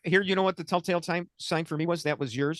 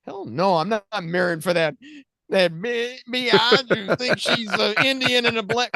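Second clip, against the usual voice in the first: mock transsexual; 5 semitones higher than usual. Host mimicking a transgender voter's reply, mocking and higher. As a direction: ham up a scornful caricature. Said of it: "the transsexual says".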